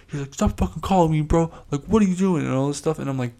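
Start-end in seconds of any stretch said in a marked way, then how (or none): 0.3-2.4 higher-pitched mock-furious rant; imitating angry yell. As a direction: play the fed-up stranger telling him off. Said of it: "and he's so pissed".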